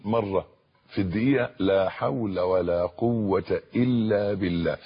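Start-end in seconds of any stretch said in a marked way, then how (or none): 1.6-4.8 drawn out word by word, slowly; slow devout recitation of لا حول ولا قوة إلا بالله; slow and deliberate. Shown as a demonstration. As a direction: give each word its full weight, unhurried. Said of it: "بالطريقة اللي أنا بقولها دي".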